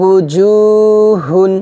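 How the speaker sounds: উজুহুন chanted very slowly, each sound held; drawn-out recitation. Shown as a demonstration. Teacher chanting উজুহুন for students to repeat. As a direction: draw out উজুহুন in a slow Quranic chant. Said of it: "আবার আমার সাথে বলেন".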